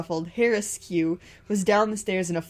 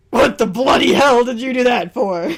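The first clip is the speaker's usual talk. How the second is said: angry shout; 5 semitones higher, 12 dB louder than usual. Acted. Green yelling at Red.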